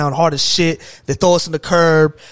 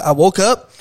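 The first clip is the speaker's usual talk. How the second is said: ordinary talk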